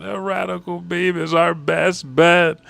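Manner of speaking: high, loud, slow mock wail; Frankie crying. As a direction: bawl the words out, hammed-up. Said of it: "Then Frankie crying".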